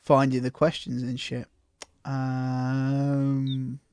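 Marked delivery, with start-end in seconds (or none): 2.0-3.8 put-on a baby bird's screech; baby seagull cry. Shown as a demonstration. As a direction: squawk a drawn-out "uh" like a seagull chick. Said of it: "That's my impression of a baby seagull".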